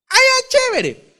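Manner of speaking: cheery acceptance. Retold or imitated. Brother happily accepting dinner invitation.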